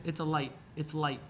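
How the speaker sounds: "light" with the final T left unreleased; American "light", stopping short on the T; barely pronounced T. Shown as a demonstration. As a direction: say "light" swallowing the T. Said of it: "Casi no se pronuncia la T".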